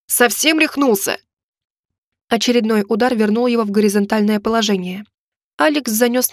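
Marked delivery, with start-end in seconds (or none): 0.1-1.2 slow, higher moaning protest; pained moan. A narrator's reading. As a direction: groan out an outraged question. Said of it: "простонал он". (со стоном, возмущённо)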